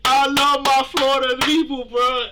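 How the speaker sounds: loud, high, fired-up shout-out; hyped shout. Real, not acted. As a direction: hype up the home crowd. Hyped shout to Florida fans.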